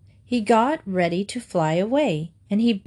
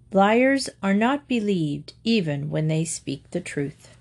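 plain reading voice

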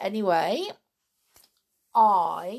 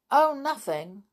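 hastily; 7 semitones higher, 8 dB louder than usual. Acted. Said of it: "said the boy hastily".

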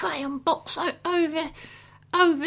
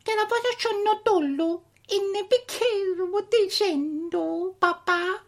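fake Italian. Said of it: "Italian gibberish".

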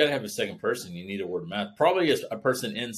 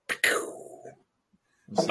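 whispering. Real, not acted.

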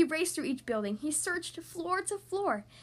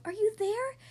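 searching call, pitched higher; raised questioning call; 6 semitones higher than usual. A narrator's reading. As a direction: call out for someone lost. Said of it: "and called".

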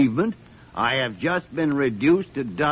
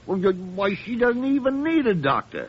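put-on offended old doctor protesting; indignant; 6 semitones higher than usual. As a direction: pitch climbing higher in disbelief. Gillespie indignant about trivial case.

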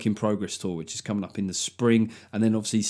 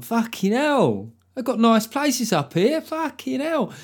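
high, slow amazed swearing; high, drawn-out mock astonishment; astonished exclamation; 11 semitones higher, slower than usual. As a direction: gasp in disbelief at nice northern towns. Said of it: "I'm like".

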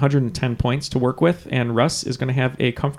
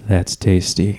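ASMR voice; 5 semitones lower, 4 dB louder, much slower than usual. Pretend. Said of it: "A S M R".

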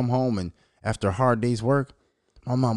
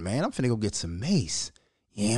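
normal talking voice

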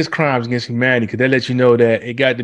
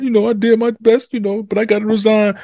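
much higher, mock-weepy prime minister; mock teary resignation; 9 semitones higher than usual. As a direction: parody a tearful farewell, voice pitched way up. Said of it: "she was all crying, teary-eyed".

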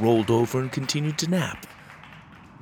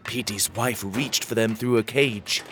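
plain reading voice

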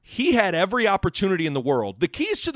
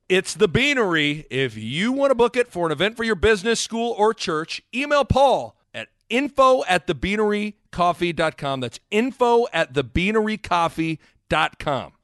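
upbeat sponsor read, deliberately emphatic; 3 semitones higher, 3 dB louder than usual. Acted. Host pitching the sponsor's new drink, announcer style. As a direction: sell it like a radio announcer.

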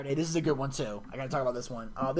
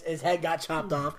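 normal talking voice

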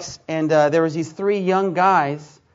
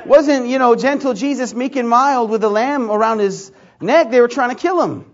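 louder and higher, forceful preaching at them; heated retort; 5 semitones higher, 7 dB louder than usual. Retold or imitated. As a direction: hammer the point home to the boys. Retold heated reply to group.

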